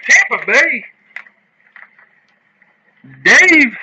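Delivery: loud, high shout of the hit; excited call-out. Real, not acted. Host announcing a team hit to its winner, excited call-out.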